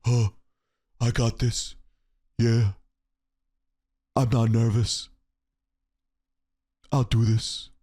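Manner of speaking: low mock stage whisper with long pauses; Solo. Host mocking a wrestler who whispers, low whispery impression. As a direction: imitate an underwhelming tough guy, whispery and halting. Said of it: "and I whisper".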